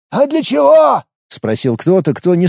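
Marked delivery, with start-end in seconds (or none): 0.1-1.0 surprised question. A narrator's reading. Кто-то спрашивает из толпы.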